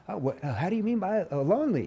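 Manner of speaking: high, bouncy playful take. Shown as a demonstration. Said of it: "I can't be all, like, playful".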